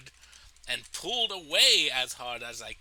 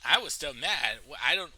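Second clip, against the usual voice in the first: ordinary talk, nothing marked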